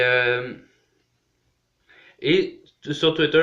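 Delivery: usual speaking voice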